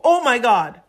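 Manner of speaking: loud surprised oh my God; amazed gasp. Retold or imitated. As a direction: caught off guard by a sign. Reacting to a sign.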